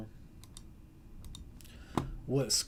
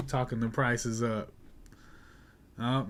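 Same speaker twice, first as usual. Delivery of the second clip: usual speaking voice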